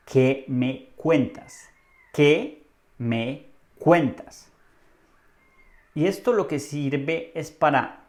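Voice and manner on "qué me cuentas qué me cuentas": "¿Qué me cuentas?" very slowly, word by word; slow model phrase. Shown as a demonstration. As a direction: pause after each word of "¿Qué me cuentas?" for learners. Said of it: "Repite después de mí". ("¿Qué me cuentas?" muy despacio, palabra por palabra)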